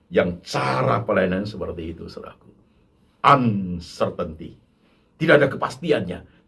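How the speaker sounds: ordinary talk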